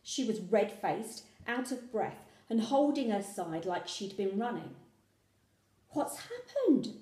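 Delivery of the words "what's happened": wary question. A narrator's reading. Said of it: "I asked warily".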